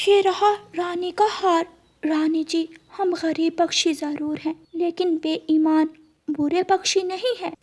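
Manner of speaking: put-on high, soft child bird; a small son warning his mother gently; little chick voice. Chick warns mother not to trust crow, high and soft.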